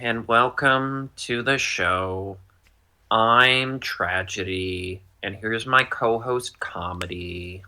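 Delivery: slow, drawn-out downcast host; gloomy welcome, sinking at the line ends; Tragedy mask. Acted. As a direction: drag each word out mournfully. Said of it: "another voice of yours".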